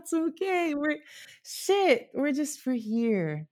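high, teary and laughing comfort; tearful comfort. Real, not acted. Comforting the crying interviewer while tearing up and laughing. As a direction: soothe her while choking up yourself.

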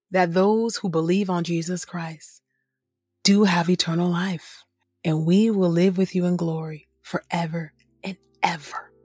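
usual speaking voice

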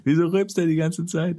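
high, mock-scandalised complaint; mock indignant. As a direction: sound scandalised by the cartoon. Both mock the cartoon.

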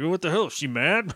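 blustering question, then dopey grunting; Adam Baldwin. Retold or imitated. Host imitating an actor who isn't acting, mock macho bluster. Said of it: "Adam Baldwin is really just like".

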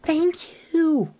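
put-on high, breathy child's voice; little ghost girl. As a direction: speak as a small, shy ghost girl.

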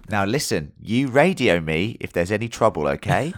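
brief him like a worried sergeant; fatherly officer voice. Pretend. Playing officer sending soldier out.

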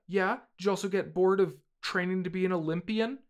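high, loud, sarcastic questioning; scolding dad. Acted. Acted father scolding his son.

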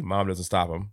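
ordinary talk, nothing marked

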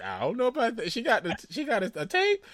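high-pitched mimicry, breaking into laughter; mock your friend's clueless answer, cracking up; laughing, squeaky retelling; mocking imitation of friend. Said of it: "and you're like".

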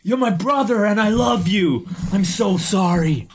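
high, overwrought declaration of love; mock emotional. Co-host voicing Roddy to his brother, mock emotional. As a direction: pour out a hammed-up apology. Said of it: "It's very emotional".

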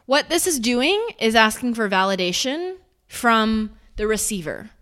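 ordinary talk, nothing marked

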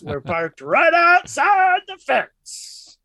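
megaphone announcement. Acted.

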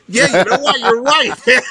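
high, laughing mock agreement; high giddy "you're right, man"; amused agreement. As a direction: play your own delighted reply with a laugh. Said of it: "And I'm like".

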